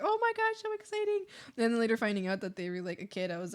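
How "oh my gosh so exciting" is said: much higher, squealing delight; excited squeal. Retold or imitated. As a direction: squeal with glee. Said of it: "So I was like".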